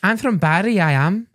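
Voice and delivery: high-pitched mock Welsh accent; party-piece Welsh accent. Shown as a demonstration. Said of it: "I can do Welsh".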